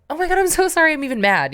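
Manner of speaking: high-pitched, acted rush of apology; gushing apology. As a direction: play someone instantly disarmed and sorry. Acted reply to a disarming apology.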